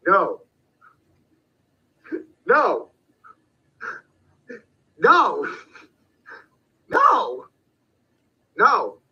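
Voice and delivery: repeated high no, each punched; emphatic no. Shown as a demonstration. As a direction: bark out each no. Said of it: "saying no".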